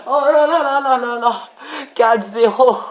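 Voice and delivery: high, loud delighted laughter and cries; overjoyed gamer celebrating four nil; laughing with joy. Real, not acted. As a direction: laugh in disbelief at the goal. Celebrating a fourth goal.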